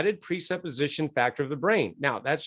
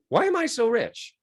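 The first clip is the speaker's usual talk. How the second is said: higher repeated question; 8 semitones higher than usual. Shown as a demonstration. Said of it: "And then I said".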